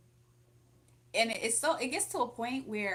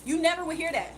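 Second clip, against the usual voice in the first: raised voice; 8 semitones higher, 9 dB louder than usual. Real, not acted. Emphatic outrage.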